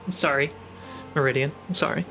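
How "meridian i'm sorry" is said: usual speaking voice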